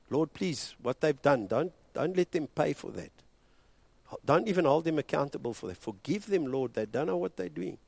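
soft, intimate pleading; quiet plea. A narrator's reading. Preacher voicing Stephen's last prayer, softly pleading. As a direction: murmur the plea gently. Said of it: "the words came out".

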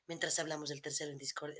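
low, soft, sultry murmur; sensual voice. Pretend. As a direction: purr it low and seductive. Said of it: "vamos a hacer voz sensual".

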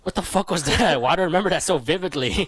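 as if still shaken by the dream; a retold startle, lightly played; mock shocked. Retold shock after waking.

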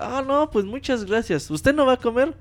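high, chirpy squirrel voice; Conker voice. Retold or imitated. Squirrel politely asks the count why he eats nothing, high cartoon voice.

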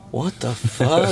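recounting a baffled first look around; retold bewilderment. Waking up to Mississippi culture shock.

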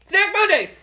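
loud, high catchphrase call; shouted catchphrase. Acted. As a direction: yell the show's catchphrase. Opening show catchphrase.

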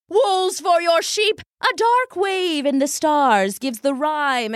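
high, theatrical stage prophecy; grand fortune teller drowning out the boy; doom-laden soothsayer. Acted. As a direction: bellow out doom like a stage oracle.

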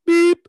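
loud, very high beep; imitated phone beep. Prankster mimics his friend's phone key, high loud beep. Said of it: "Can you hit a beep?".

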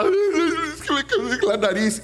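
a hint of a sheepish son confessing. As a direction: say it like a boy owning up. Hijo explica al padre. (hijo confesando tímidamente al papá que se quebró la nariz)

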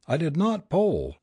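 high-pitched, understated retort; mild shouted denial. A narrator's reading. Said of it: "shouted the neighbor".

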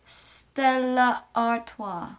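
Stella Artois slowly as "STEL-la ar-TWA"; careful pronunciation. Shown as a demonstration. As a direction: spell out each syllable of Stella Artois. Demonstrating a brand's pronunciation.

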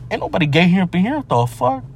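usual speaking voice

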